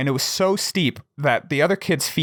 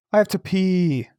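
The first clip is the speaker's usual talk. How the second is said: lightly voiced kid, higher pitch; retold sheepish plea; 5 semitones higher than usual. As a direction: imitate a kid reluctantly confessing to his tentmates. Imitating Nate's night-time plea to tentmates.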